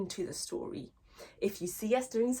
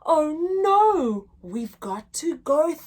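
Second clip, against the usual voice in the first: lively, sing-song picture-book reading; reading to children; 3 semitones higher, 6 dB louder, slower than usual. Acted. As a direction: read it big for little listeners. Teacher reads the bear hunt story.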